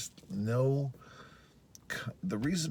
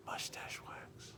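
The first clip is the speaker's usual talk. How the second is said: whispered trailing off; barely audible afterthought; 15 dB quieter, slower than usual. Real, not acted. Trailing off, to himself.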